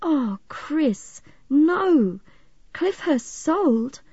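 high, shocked disbelief; stricken, drawn-out protest; dismayed. A narrator's reading. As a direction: take in awful news. Friend hears devastating news of sale.

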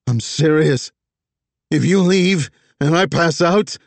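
high, loud desperate call; yelling. Acted. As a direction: shout it after her. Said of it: "Vess was still yelling".

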